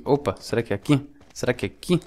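usual speaking voice

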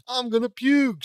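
high, loud nauseous scream. Acted. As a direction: yell it about to be sick. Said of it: "screams Hawk".

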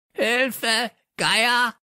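very high, squawked cry; croaking parrot. A narrator's reading. Pet bird crying for help, high croaking squawk. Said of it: "krächzte Störtebeker".

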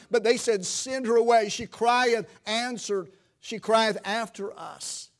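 loud, high, driving sermon voice; rising revival preacher in full cry; preaching at full force. Acted. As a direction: build the fervour, hammering each phrase.

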